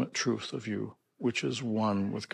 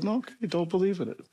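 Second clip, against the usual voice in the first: breezy dismissal; 8 semitones higher, faster than usual. Retold or imitated. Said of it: "I said".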